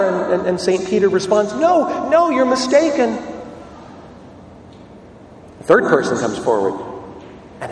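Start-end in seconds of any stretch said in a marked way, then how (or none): 1.5-3.2 high, nervous brush-off; panicked denial. Acted. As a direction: wave them away, voice leaping up in fear. Peter's second denial.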